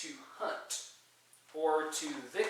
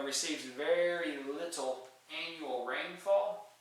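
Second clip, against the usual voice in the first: ordinary talk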